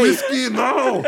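high, slow, laughing cry; mock scolding. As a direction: call the horse off in mock alarm. Cohost mock-scolds a horse.

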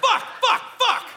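mock shriek in a cereal aisle; shout. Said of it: "the cereal aisle going".

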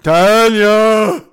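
loud, high drawn-out holler; hollering a name. Retold or imitated. Storyteller imitates Bubba hollering to Tanya, loud holler. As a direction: call out the name like a loud drunk.

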